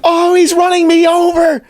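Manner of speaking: high, slow, hammed-up wail to the officer; over-exaggerated victim. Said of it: "completely over-exaggerating".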